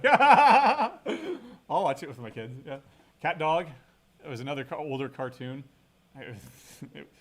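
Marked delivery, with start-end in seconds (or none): 0.0-2.5 laughing hard, squeaky and high; words squeezed out through a big laugh; real laugh breaking through. Real, not acted. Laughing about watching with kids.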